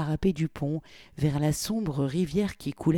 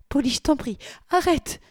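much higher, pleading on the edge of panic; near panic; 11 semitones higher, 4 dB louder than usual. A narrator's reading. Victoria pleading with Polly, near panic, pitch climbing. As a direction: beg in rising alarm. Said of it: "au bord de la panique".

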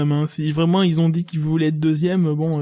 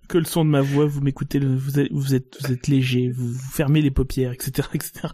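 hypnotist voice; 4 semitones lower than usual. Pretend. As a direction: lull them like a hypnotist. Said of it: "On dirait un hypnotiseur".

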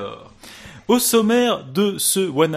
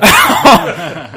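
loud laugh; 4 semitones higher, 10 dB louder than usual. Real, not acted. Laughs after jibe.

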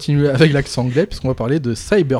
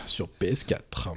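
whisper; 6 semitones lower, 12 dB quieter, slower than usual. Real, not acted. Whispered aside.

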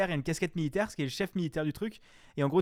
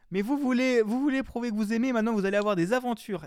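higher, louder hammed-up Spanish accent; mock Spanish accent; 7 semitones higher, 4 dB louder than usual. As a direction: play the pompous book with a Spanish accent. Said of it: "avec un immense accent italien, comme ça, italien ou espagnol". (faux accent espagnol exagéré)

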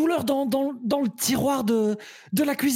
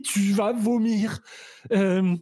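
normal talking voice

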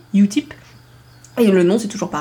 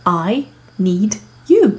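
slow example; much slower than usual. Shown as a demonstration. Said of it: "ça va se transformer en".

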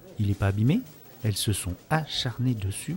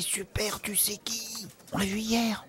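whisper; 11 semitones higher, 5 dB quieter, slower than usual. Real, not acted. Secret about Corinne.

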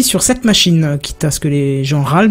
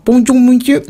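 high-pitched, badly faked Chinese accent; mock Chinese accent; 7 semitones higher, 3 dB louder than usual. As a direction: do a clumsy caricature of a Chinese accent. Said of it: "je vais le faire avec un accent chinois". (faux accent chinois maladroit)

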